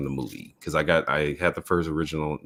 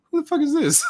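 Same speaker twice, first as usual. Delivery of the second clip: laughing shocked outburst; 5 dB louder than usual. Retold or imitated. Retelling own shocked reaction in chat.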